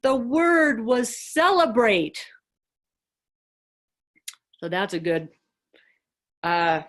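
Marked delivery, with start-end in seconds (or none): 0.0-2.2 high, loud, drawn-out mock anguish; choking voice. Abbot crying uncontrollably as he answers the monk, choking voice. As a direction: wail it slowly as if choked with tears. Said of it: "in this choking voice".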